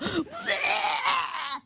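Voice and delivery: a high gasping yelp of disgust; retold choking gasp; mock shocked gasp. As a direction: gasp like you just tasted poison. Guy reacting to tequila shot.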